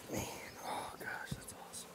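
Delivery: breathless unvoiced whisper; thrilled whisper over the queen; awed whisper. Real, not acted. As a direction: hush it in wonder. Whispering while handling the queen.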